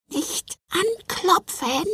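very high, slow, tiny-creature voice; quiet gingerbread man call. Acted. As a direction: call softly in a squeaky little voice. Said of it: "rief er leise".

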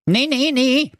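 put-on high, thin old nanny goat; a grateful old goat's voice; frail breathless old person. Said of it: "sagte die alte Geiß dankbar".

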